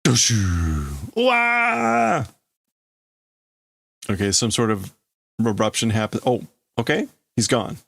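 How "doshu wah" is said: hammed-up "wah" wail after a falling whoosh; imitated sound effects. Reader sounding out an eruption, acted scream.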